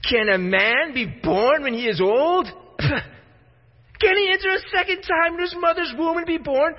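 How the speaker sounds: scornful mockery. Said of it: "There's scorn dripping from the words here".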